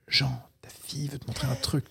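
discreet whisper, imitating a wife; whispered nudge. Wife reminding a distracted dad about his daughter, whispered.

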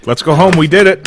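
loud, high cheering; mock celebration. Townies cheering the caught shark, loud and excited. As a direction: cheer like the job is done.

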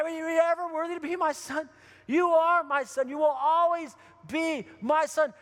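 high, loud, stretched-out affirmation; emphatic insistence. Retold or imitated. Father telling his returned son he belongs, emphatic insistence. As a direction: drive each phrase home with fatherly conviction.

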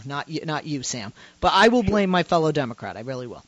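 normal talking voice

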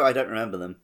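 normal talking voice

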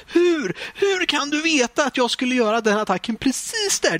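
starts very high then settles back down; exasperated yelling at the screen. Retold or imitated. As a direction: rant at an unfair computer opponent. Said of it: "sitter och skriker åt".

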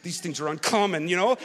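low, muttered complaining to himself; mock grumbling rant. Ranting in the driveway at the snow, low mock grumble. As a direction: grumble it low like a put-upon commuter. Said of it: "I'm already doing the habit".

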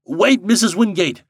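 high, loud call to stop; crying out. A narrator's reading. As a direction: call after someone running ahead. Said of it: "cried Mister Kravitz".

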